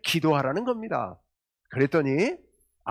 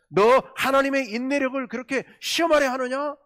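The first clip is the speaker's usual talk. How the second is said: higher, louder prophet's rebuke; strong rebuke; 9 semitones higher, 4 dB louder than usual. Acted. Isaiah rebuking King Ahaz, voiced higher and louder. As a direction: scold the king as Isaiah would. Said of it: "라는 그런 강력한 책망이죠".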